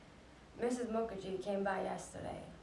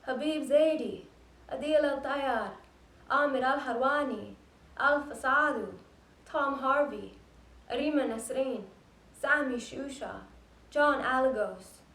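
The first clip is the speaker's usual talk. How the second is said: loud, higher-pitched names, each one set apart; shouted roll call; 4 semitones higher, 9 dB louder than usual. Retold or imitated. Imitating Vinesh on the roof for the dead, shouting names. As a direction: call out each name to the whole street. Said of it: "His voice was gone from screaming".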